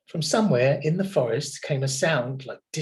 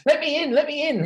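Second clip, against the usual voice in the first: begging; 13 semitones higher, 4 dB louder, faster than usual. A narrator's reading. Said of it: "he begged".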